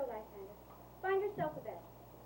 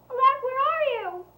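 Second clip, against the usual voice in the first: high, raised, panicked cry; frightened call; 6 dB louder than usual. Acted. Woman alone in the woods seeks lover, frightened call. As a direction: call out desperately for him. Calls for missing lover in fear.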